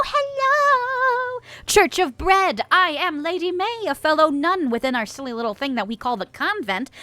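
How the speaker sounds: ordinary delivery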